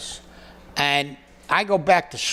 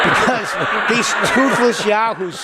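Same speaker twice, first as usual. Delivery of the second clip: laughing; 4 semitones higher than usual. Real, not acted. Laughing while mocking.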